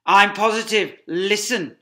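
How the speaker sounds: insist, urgent and loud; tense Mr Fox. Acted. Fox telling his wife to listen, urgent. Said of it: "Mr Fox answering wife".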